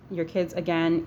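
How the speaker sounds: normal talking voice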